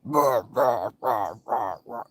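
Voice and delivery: low, loud croaking caw; imitating the crows in a low rough caw; grizzled old man crow. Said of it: "sounds like a grustly old man going".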